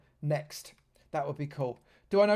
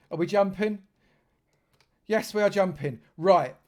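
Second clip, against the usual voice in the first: normal talking voice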